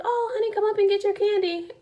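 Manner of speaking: high, coaxing lady's voice; friendly candy lady. Retold or imitated. Lady calls a child up for candy, high coaxing imitation. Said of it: "this lady was like".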